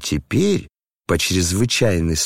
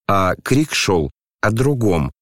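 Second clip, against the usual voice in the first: ordinary delivery, nothing marked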